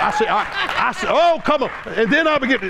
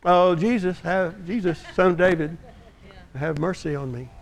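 soft, low, half-hearted plea; mock the meek church prayer; timid polite prayer; 3 semitones lower, 4 dB quieter than usual. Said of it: "what we would do in the church today is we say".

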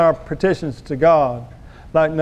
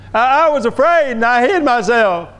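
higher, stammered start, acting scared; mock fearful confession; 7 semitones higher than usual. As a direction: imitate Adam's frightened excuse. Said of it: "This is not the language of God".

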